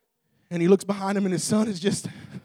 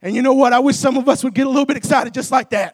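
loud, driving revival sermon; full-force preaching; 10 semitones higher, 13 dB louder than usual. Real, not acted. Preacher urging the church to praise before the miracle, full-force preaching. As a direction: preach it hard, punching the ends of phrases.

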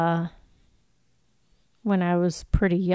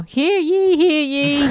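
town crier; 6 semitones higher, slower than usual. Pretend. Mock court crier call.

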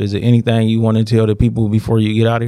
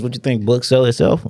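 usual speaking voice

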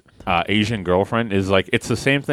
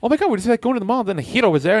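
put-on high, quick animated girl; imitated girl character; 11 semitones higher, much faster than usual. Girl from Big Hero six chatting about the mall, high and quick. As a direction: mimic her chatty, high-pitched lines. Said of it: "she'll be like".

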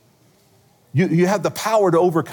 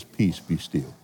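low, soft, still command; calm command; 11 semitones lower, 7 dB quieter, slower than usual. Retold or imitated. Jesus calming the waves, low and soft. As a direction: hush the storm, low and quiet. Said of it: "he just says".

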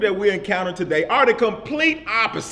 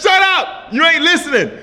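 high, loud shout through a laugh; yelling; 9 semitones higher, 7 dB louder than usual. Retold or imitated. Preacher retells yelling at a friend who kept interrupting: laughing high shout. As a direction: bark it at her, half laughing. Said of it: "I yelled at her".

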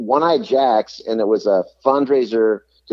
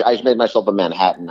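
ordinary talk

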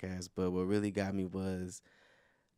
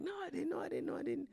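high squeaky denial; 9 dB quieter, faster than usual. Retold or imitated. Denying he got bigger, squeaky.

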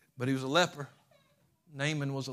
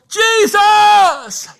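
a full-voiced cry, far higher and louder; shouting; 17 dB louder than usual. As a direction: scream his name across the crowd. Beggar crying out in trouble.

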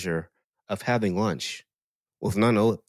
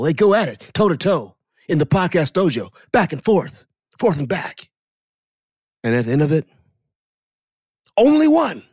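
Dwayne Johnson wrestling voice; 2 semitones higher than usual. Retold or imitated. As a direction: stretch every word like a wrestling promo entrance. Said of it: "'cause that was his wrestling voice".

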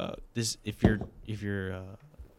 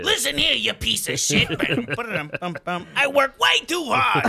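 much higher, loud shouty cartoon voice; cartoon mole voice; 12 semitones higher, 6 dB louder than usual. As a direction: yell it as a cranky cartoon mole. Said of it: "Mister Rossetti's voice".

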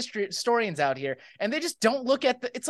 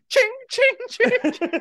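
high jingling ching sounds; coin jingle; 13 semitones higher, 3 dB louder, much slower than usual. Acted. The Pope walks off with jingling gold, high ching sounds. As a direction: mimic coins clinking.

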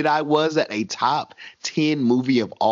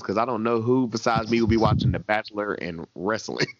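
ordinary delivery

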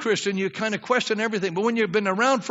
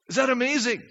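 normal talking voice

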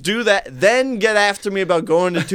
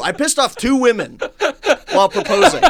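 laughing, pitch climbing higher; 9 semitones higher, 4 dB louder than usual. Real, not acted. Teller laughs at angering two women while proposing. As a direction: laugh through the admission.

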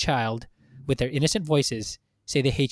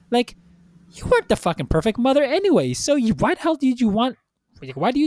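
higher-pitched, heated rant at the accused; indignant scolding; 5 semitones higher than usual. Acted. Fan scolds a character for failing her son, indignant. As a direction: tear into her, pitch jumping up on each charge.

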